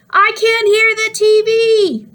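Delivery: high, slow, loud holler. A narrator's reading. Boy hollering that he can't hear the TV. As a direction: holler in frustration. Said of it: "Ty hollered".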